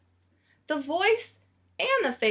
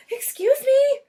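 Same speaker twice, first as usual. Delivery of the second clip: much higher, louder anxious lady's voice; frantic Missus Prim; 13 semitones higher, 7 dB louder than usual. A narrator's reading. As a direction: urgently catch the clerk's attention. Prim frantically searching for Lyle.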